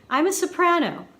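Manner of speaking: high laughing soprano lilt. Real, not acted. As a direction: show off the soprano range. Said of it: "I'm sure you can tell".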